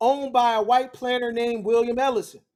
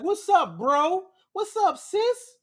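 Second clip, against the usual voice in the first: mock friendly greeting; 6 semitones higher, slower than usual. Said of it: "be like".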